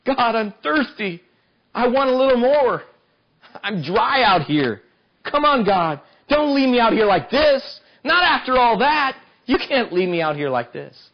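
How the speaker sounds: pleading prayer. Acted. A parched believer begs God not to abandon him. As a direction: beg God not to leave you dry.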